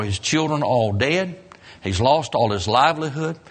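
ordinary talk, nothing marked

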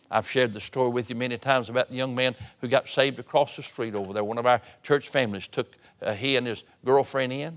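usual speaking voice

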